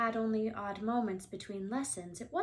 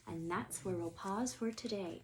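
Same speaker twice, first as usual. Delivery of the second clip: ordinary delivery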